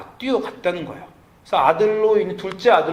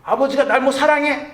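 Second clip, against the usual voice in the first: a lightly voiced sulky kid, higher and louder; mock whiny retort, much higher; resentful child; 9 semitones higher, 4 dB louder than usual. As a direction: talk back like an ungrateful teenager. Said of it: "한국에서 아이들이 그렇게 하는 겁니다".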